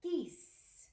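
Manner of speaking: ordinary talk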